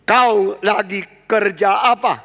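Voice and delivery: loud, slow scolding; stern father. Retold or imitated. A father scolds his child skipping school, slow and loud. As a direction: demand it like a father catching a truant. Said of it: "Lalu papa bilang".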